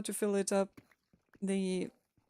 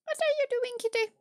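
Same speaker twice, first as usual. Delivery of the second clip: high, sing-song pet voice; talking to a cat; 4 dB louder, faster than usual. Real, not acted.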